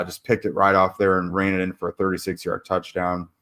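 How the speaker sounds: ordinary delivery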